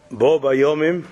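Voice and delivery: normal talking voice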